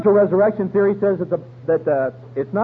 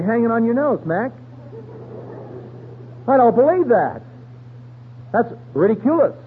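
scoffing retort; 5 semitones higher than usual. Retold or imitated. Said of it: "I'd say".

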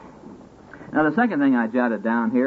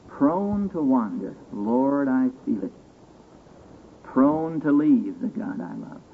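slow solemn quote; slower than usual. Acted. Quoting hymn lines slowly.

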